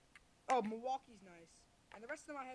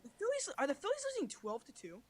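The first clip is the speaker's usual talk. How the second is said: shocked surprise; 5 semitones higher, 10 dB louder than usual. Real, not acted. Sudden score news mid chat.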